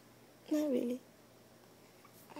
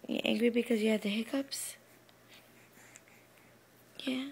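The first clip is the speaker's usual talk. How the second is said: whispering; 6 semitones lower, faster than usual. Real, not acted. Mother soothing upset child.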